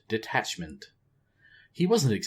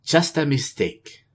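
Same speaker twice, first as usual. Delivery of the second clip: calm; 6 semitones higher, 6 dB louder than usual. A narrator's reading. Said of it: "Talanji said, calmly".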